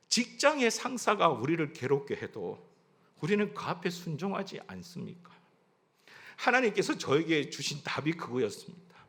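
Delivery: ordinary talk, nothing marked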